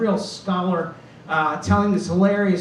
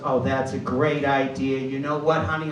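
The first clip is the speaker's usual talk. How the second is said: mom; 5 semitones lower than usual. Retold or imitated. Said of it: "My mom turned to me and she goes".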